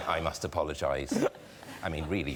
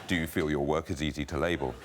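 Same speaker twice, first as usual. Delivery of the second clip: lightly lowered interviewer voice; lower, less nasal interviewer voice; 4 semitones lower, faster than usual. Acted. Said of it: "I'll do a slightly lower voice for me, less nasal".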